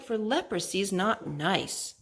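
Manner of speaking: ordinary delivery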